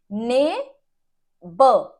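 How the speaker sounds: normal talking voice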